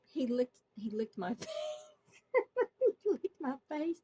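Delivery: high, giggly retelling; laughing in disbelief. Real, not acted. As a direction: barely get it out through laughter. Giggles while retelling absurd moment.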